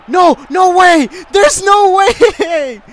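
high, drawn-out shout of disbelief; disbelieving scream. Real, not acted. Gamer stunned by the play, screaming in disbelief. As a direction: scream it slowly, very high and loud.

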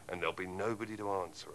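ordinary talk

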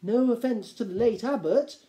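timid minor nobleman; hesitant lord. A narrator's reading. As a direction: tread carefully before objecting. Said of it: "said one of the lesser lords hesitantly".

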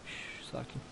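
soft hushed calming; hushing. Pretend. Hushing a creeper so it stays calm. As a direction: shush it gently to calm it down.

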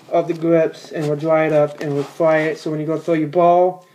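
ordinary delivery

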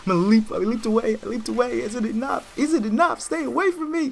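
very high, loud panicked squeal; frantic high-pitched cries under attack; panicked gamer yelling. Real, not acted. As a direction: shriek as you scramble to escape. Panicked escape from attackers in game.

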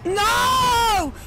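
loud, high cry of no; real despairing yell; anguished shout. Real, not acted. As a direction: yell at losing it so close. Dying just before the finish.

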